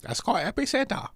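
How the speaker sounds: put-on high-pitched caricatured Chinese accent; hammed-up Chinese accent correction; mock Chinese accent. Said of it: "try and talk like a Chinese".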